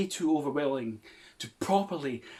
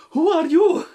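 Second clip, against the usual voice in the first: hammy shock; 6 semitones higher, 7 dB louder, slower than usual. Acted. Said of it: "add in a little something".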